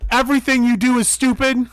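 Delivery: mock insult. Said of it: "look at that girl in the face and go".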